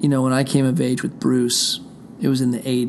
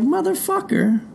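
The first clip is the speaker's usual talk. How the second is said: 1 mock outburst. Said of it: "And I was like".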